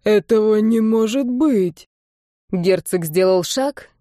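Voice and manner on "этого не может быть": slow, drawn-out disbelief; stunned dying villain, words stretched out. A narrator's reading. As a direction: slowly refuse to believe it. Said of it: "неверяще прошептал он".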